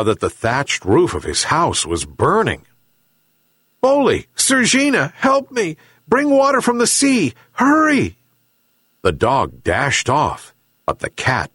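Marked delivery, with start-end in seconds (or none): 3.8-8.2 high-pitched frantic calling; urgent cry for help. A narrator's reading. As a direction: call out for help as the house burns. Old man calls out, house burning.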